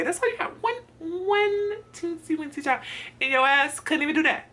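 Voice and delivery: very high, stretched-out mock wail; hammed-up squeaky exasperation; whiny outrage. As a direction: whine in disbelief at the other driver. Acted outrage at truck driver.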